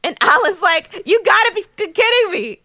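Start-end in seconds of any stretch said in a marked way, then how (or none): 1.1-2.6 high-pitched mock disbelief; incredulous outburst. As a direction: act out scandalised disbelief, pitched way up. Acting out Alan's outraged disbelief.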